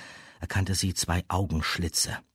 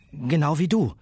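put-on higher, louder young girl; 10 semitones higher, 6 dB louder than usual. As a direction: speak up as a girl. Girl character speaks.

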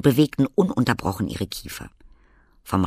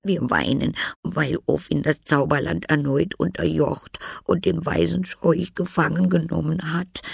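higher, tearful little voice, understated; mild sobbing; 8 semitones higher, 4 dB louder than usual. A narrator's reading. Käuer telling of Orphin's return, mildly tearful. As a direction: sound sad and tearful. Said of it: "fingen die Käuer zu schluchzen an".

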